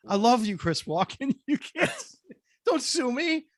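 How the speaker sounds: high-pitched, nervous comic begging; mock pleading. Nervous host begging the actor not to sue, mock pleading. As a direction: plead for mercy, half laughing.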